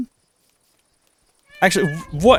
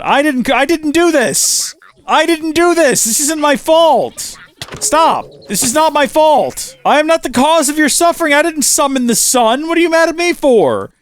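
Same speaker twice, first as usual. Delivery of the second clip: panicked pleading; 11 semitones higher than usual. Acted. Pleading with angry burning horse.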